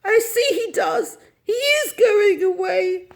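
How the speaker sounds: hammed-up tearful lament; sobbing dismay. A narrator's reading. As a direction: wail as if abandoned. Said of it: "She sobbed".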